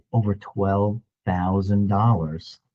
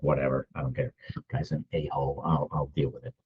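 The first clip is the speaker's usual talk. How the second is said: resigned mutter; 5 semitones lower, 9 dB quieter than usual. Retold or imitated. Brushing off a rude salesman.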